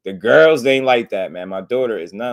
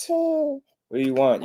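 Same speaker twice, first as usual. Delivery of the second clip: drawn out, very high and slow; much slower than usual. Real, not acted. Father cooing slowly at his fussy son. Baby talk to crying son.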